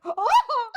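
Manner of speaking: high, loud imitated shriek; mock scream. Imitating a slapped sketch character, mock scream. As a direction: shriek like someone just slapped.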